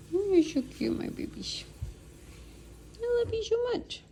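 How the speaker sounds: high, soft cooing at the dog; squeaky doting pet voice. Real, not acted. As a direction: coo sweetly to a pet. Cooing to her pets.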